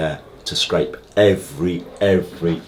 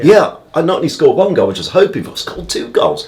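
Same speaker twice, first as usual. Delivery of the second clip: excited; 9 semitones higher, 6 dB louder than usual. Retold or imitated. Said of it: "now I can get excited".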